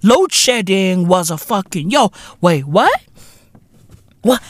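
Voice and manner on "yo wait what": ordinary delivery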